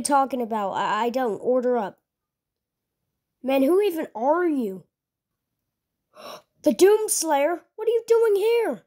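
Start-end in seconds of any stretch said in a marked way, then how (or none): 6.1-8.8 gasp, then jump way up in pitch; shocked squeak at a famous face; gasping surprise. Acted. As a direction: starstruck cashier recognising a legend. Cashier recognises the Doomslayer.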